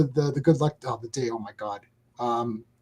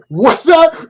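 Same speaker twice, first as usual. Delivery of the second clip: loud, high-pitched mock outrage; indignant challenge; 9 semitones higher, 8 dB louder than usual. Retelling the Scottish coworker's offended reaction, mock indignation.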